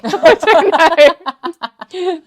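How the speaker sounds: laughing, high and slow. Real, not acted. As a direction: laugh through the words. Laughing at own joke.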